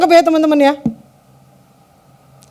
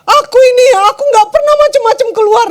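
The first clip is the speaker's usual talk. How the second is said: acted wounded wife, high and raised; tearful screaming; 6 semitones higher, 4 dB louder than usual. Wife protesting to her husband over his insult, tearful yelling. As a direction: plead loudly as if crying, building in volume. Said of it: "waktu nangis dia teriak-teriak".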